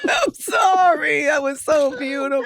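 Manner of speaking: loud, high, tearful outburst; overwhelmed, real tears of joy; happy crying. Real, not acted. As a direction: cry and laugh at once, high and loud. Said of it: "those are, like, tears of joy".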